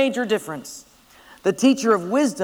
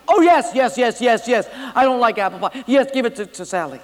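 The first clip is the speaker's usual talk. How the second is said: grin and bear it; trapped boy faking delight; forced agreement; 3 semitones higher, 3 dB louder than usual. Acted.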